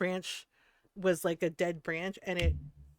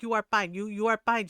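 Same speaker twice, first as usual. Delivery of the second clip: louder, insistent mother imitation; mock-scolding, dismissive mom voice; Filipino mom; 2 semitones higher, 5 dB louder than usual. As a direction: brush off the hurt child as a Filipino mom. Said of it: "She's just Filipino".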